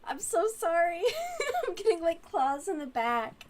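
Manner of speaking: high, loud laughing apology through pain. Real, not acted. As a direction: apologise while laughing helplessly. Cat clawing her on stream.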